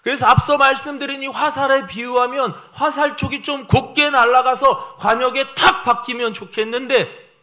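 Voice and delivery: high, loud sustained sermon delivery; impassioned preaching. Real, not acted. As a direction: press each phrase home to the congregation. Preaches with sudden force.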